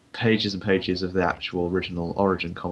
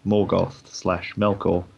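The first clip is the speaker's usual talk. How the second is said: usual speaking voice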